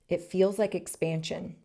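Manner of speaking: usual speaking voice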